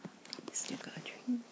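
hushed ASMR whisper, close to the mic; soft whisper. Acted. To a friend at the pub, whispered ASMR comfort. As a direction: breathe the words softly like a secret.

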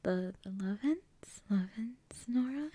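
hushed whisper so nobody wakes. Real, not acted. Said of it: "I can't really talk too loudly".

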